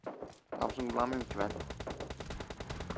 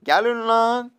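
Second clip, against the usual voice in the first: challenge shout; 9 semitones higher, 5 dB louder, much slower than usual. Real, not acted. Challenging a crowd of enemies.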